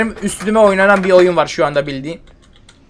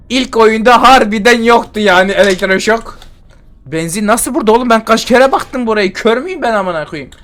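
higher and louder, fast frustrated ranting; indignant rant; 4 semitones higher, 4 dB louder than usual. Real, not acted. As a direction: vent at yourself for missing it. Ranting after finding gasoline.

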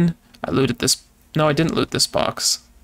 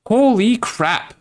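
amazed exclamation; 8 semitones higher, slower than usual. Real, not acted. Finds a wall loaded with junk.

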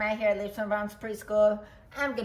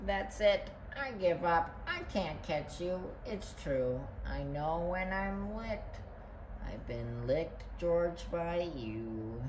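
quiet, low, resigned; worn-out surrender; defeated; 3 semitones lower, 8 dB quieter than usual. A narrator's reading. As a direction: give up, voice sinking lower and softer. Nellie gives up the chase.

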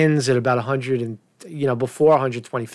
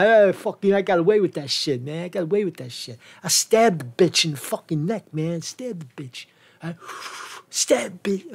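lightly voiced street braggart, dropping to softer asides; bragging perpetrator; 3 semitones higher, 4 dB quieter than usual. Host acting out a suspect boasting about the crime, mock bragging. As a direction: brag it out, then let it tail off quietly.

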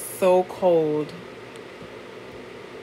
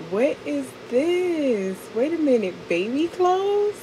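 mock surprise; 8 semitones higher than usual. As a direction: act out an uncle stunned by baby clothes. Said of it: "Uncle Pete is like".

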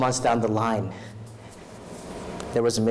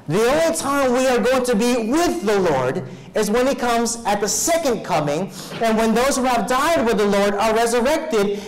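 raised, ringing preacher's voice, driving each verse home; full-force preaching; 7 semitones higher, 4 dB louder than usual. Acted. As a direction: proclaim the scripture to the congregation.

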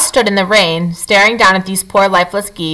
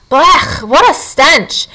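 high, slow groan of disgust; disgusted badger; 6 semitones higher, much slower than usual. A narrator's reading. Hungry badger recoiling from a rotten-smelling corpse in disgust. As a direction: recoil from the stink. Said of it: "face twisted with disgust".

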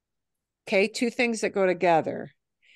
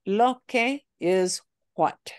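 slow explanation; slower than usual. Shown as a demonstration. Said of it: "the lo is not gonna mean it anymore".

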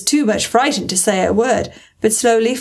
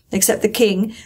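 ordinary delivery, nothing marked

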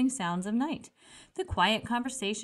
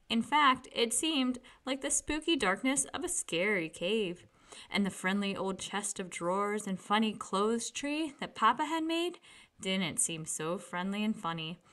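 usual speaking voice

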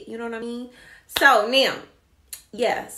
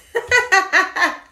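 loud burst of laughter; 9 semitones higher, 9 dB louder than usual. Real, not acted. Laughing after mock threat.

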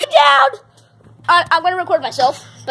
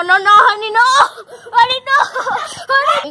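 alarmed shouting; 6 semitones higher, 4 dB louder, faster than usual. Real, not acted. Cousin going down stairs.

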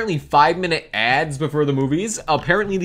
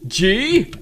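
ordinary talk, nothing marked

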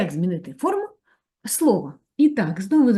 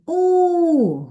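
drawn-out vowel; 11 semitones higher, 5 dB louder than usual. Shown as a demonstration. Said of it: "звукова модель слова".

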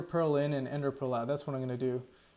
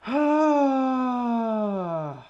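loud high drawn-out groan; exasperated groan; 9 semitones higher, 7 dB louder than usual. Real, not acted. Gamer groans loudly at the hated void area, frustrated groan.

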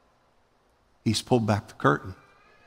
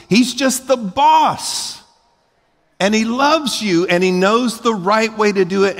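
high-pitched, emphatic preacher; impassioned preaching; 8 semitones higher, 4 dB louder than usual. Real, not acted. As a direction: drive it home to the congregation, pitch raised. Preaches at full force.

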